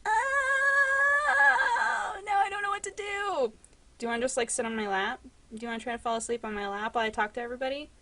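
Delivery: high, soft sing-song. Real, not acted. Owner crooning to her cat, high sing-song.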